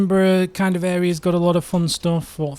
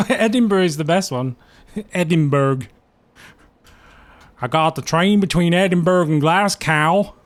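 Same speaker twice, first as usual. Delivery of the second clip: mock American accent; 3 semitones higher, 4 dB louder than usual. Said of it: "I can't do an American accent".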